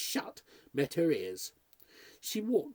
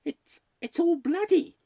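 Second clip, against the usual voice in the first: high, loud, stammered horror; horrified revelation; 7 dB louder than usual. A narrator's reading. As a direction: blurt it out, aghast. Maid blurts out horrifying discovery.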